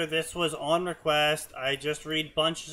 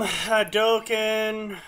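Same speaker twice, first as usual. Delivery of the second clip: loud drawn-out Hadoken yell; Hadoken shout; 7 semitones higher, 5 dB louder, much slower than usual. Pretend. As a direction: bellow it like a fighting game move. Shouting a fighting game move.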